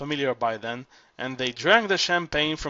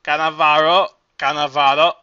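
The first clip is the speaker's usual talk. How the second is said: loud hoarse squawk of a drowning man; hoarse parrot cry; 9 dB louder than usual. Acted. Green parrot calling its mistress's name, hoarse squawk. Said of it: "in the hoarse voice of a drowning man".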